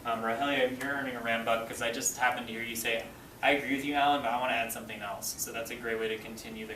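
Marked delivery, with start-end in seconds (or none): none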